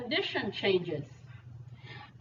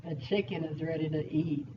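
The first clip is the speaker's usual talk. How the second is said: low, softer sly delivery; lower, hushed hint of hungry menace; other inflection; 6 semitones lower, 3 dB quieter than usual. Shown as a demonstration. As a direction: say it low, like a cartoon predator eyeing dinner. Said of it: "different inflections kinda give you different meanings".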